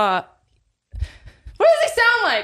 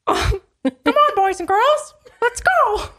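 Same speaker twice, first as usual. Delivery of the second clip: Mickey Mouse; 6 semitones higher, slower than usual. Acted. Doing a character impression, high cartoon mouse host inviting kids. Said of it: "Mickey Mouse".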